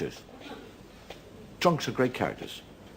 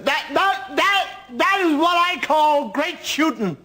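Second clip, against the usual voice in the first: put-on high, slow stammering drunk voice; drunk stammering friend; 14 semitones higher, 4 dB louder, slower than usual. Friend praising the shooting, high stammering drunk voice. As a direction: stutter it out, high and slurred.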